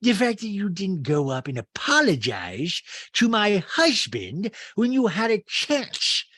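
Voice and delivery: high, loud, drawn-out mimicry; Rinna. Housewife confronting guests about her husband, loud slow imitation. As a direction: stretch each phrase in a put-on high voice. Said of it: "And Rena's like".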